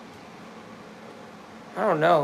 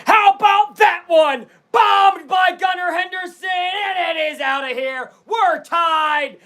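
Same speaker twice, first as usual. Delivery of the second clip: excited home run call, starting high and settling; live play-by-play; 6 semitones higher than usual. Real, not acted. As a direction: stretch out the big words like a sportscaster.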